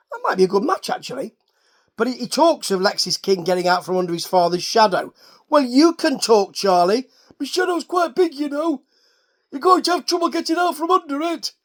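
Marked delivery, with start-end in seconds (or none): 5.5-11.5 raised, pompous British commentator voice; William Regal impression. Recapper mimicking Regal scolding Charlie, pompous raised voice. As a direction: put on a grand, lofty Regal voice. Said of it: "That's my Steven Regal impression".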